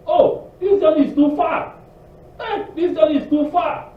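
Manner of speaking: high, loud whiny moan with a laugh; mock complaining. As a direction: ham up a grumbling traveller. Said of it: "Com-, complain".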